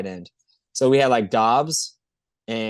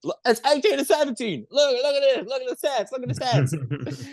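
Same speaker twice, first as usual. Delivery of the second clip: insisting high voice; 12 semitones higher, faster than usual. Retold or imitated.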